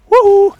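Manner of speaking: high, loud celebratory woo; excited whoop. Real, not acted. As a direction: whoop for the winning play. Caster cheers the fight.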